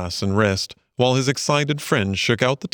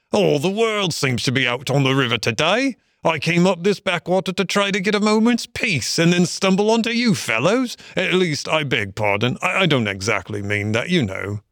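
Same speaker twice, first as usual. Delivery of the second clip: high-pitched grumbling otter, settling into apology; chatty Otter; 7 semitones higher than usual. Acted.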